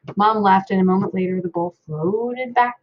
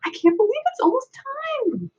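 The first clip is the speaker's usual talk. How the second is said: quivering with enthusiasm. A narrator's reading. Little brother on the piano bench, high, quivering excitement. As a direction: barely hold it in, quivering high. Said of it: "his voice quivering with enthusiasm".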